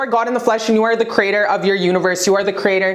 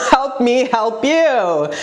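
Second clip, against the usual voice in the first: laughing; much slower than usual. Real, not acted. Repeated with laugh, slower.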